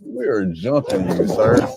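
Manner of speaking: very slow, deliberate warning; a slow, drawn-out mock threat; slow menacing threat. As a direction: spell it out slowly. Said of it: "repeated threat, slowed".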